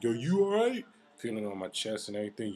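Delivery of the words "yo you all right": loud, very high-pitched teasing question. Retold or imitated. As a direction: mess with him, pitch shooting up at the end. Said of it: "he was just messing with me".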